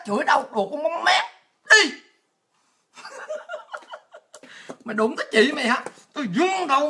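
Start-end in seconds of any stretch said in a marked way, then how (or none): 3.0-3.9 soft, very high giggle; quiet high-pitched string of ha-has; high laugh. Real, not acted. Laughs after insulting her critic.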